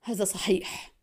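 soft, low grumble; resentful mutter. A narrator's reading. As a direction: mutter it under your breath in irritation. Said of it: "تمتم حسن في حنق".

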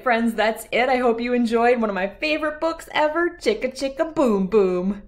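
ordinary delivery

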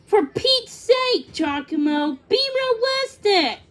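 high, loud, emphatic protest; exasperated. A narrator's reading. Thumbelina rejects the bird's plan, exasperated. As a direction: protest with pitch leaping on each phrase.

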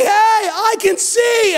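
high, slow, amazed cry of joy; overjoyed healed man. Retold or imitated. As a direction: savor every word like you just got sight back. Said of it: "and he's like".

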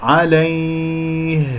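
عليه chanted very slowly, glide drawn out; soft ya demo. Shown as a demonstration. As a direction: stretch the ay of عليه, ending on a voiced h. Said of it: "هكذا ياء لين ساكنة". (ترتيل «عليه» بمد ياء اللين)

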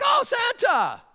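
squealing high excited shout; thrilled kids. Retold or imitated. Children spotting Santa at the mall, high squeal. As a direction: squeal with delight like a small child. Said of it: "they're like".

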